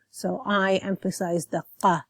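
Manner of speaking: usual speaking voice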